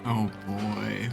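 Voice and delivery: ordinary talk, nothing marked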